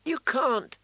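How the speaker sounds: put-on high-pitched angry little boy; angry child. A narrator's reading. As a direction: protest like a small boy in a temper. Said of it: "said Rickon angrily".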